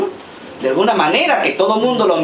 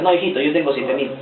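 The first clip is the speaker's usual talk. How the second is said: lower, softer patient reply; fatherly God; 6 semitones lower, 3 dB quieter than usual. Retold or imitated. God gently correcting Elijah, lower and softer.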